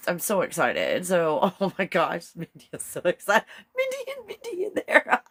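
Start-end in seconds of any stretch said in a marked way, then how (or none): 3.8-5.3 high, gleeful mock-villain cackle; evil laugh. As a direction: laugh through it like a playful villain. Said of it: "that was my evil laugh".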